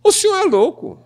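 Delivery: the mother hits back at the advisor, pitched high; mother's retort. Retold or imitated. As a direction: snap back as the shocked mother. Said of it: "Dizer não".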